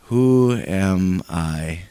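low, slow, dejected question; downcast. Shown as a demonstration. Preacher showing the congregation Moses' doubt, low and slow. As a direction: say it low and defeated. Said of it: "Say that".

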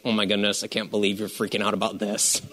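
low, soft amused God; a hint of God laughing it off; chuckling God. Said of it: "I have to feel like God chuckles".

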